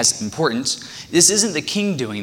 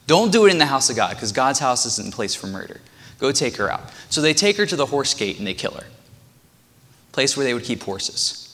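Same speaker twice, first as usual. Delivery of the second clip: usual speaking voice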